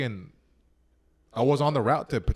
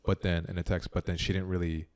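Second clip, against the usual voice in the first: ordinary talk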